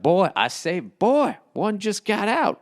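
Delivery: Foghorn Leghorn voice. Retold or imitated. Retelling Foghorn Leghorn calling the dog, high rooster impression. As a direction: ham up the blustering cartoon rooster. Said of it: "He's like".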